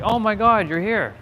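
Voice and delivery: friend's mock-shocked "Oh my God", pitched high; shocked surprise. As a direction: act out a friend's amazed greeting. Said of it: "like, shocked".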